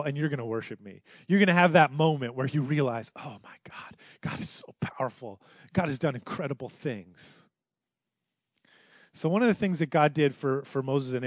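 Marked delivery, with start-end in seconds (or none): 0.0-1.0 hushed. Acted. God's promise repeated softly. 3.2-7.4 breathless whispered awe; hushed, awestruck worship; awed hush. Pretend.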